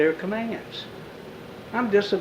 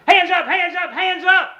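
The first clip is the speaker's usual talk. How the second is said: high barked command; uniformed officer at the car window, high shouted command; police shout; 12 semitones higher, 4 dB louder than usual. Retold or imitated. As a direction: bark it like a cop.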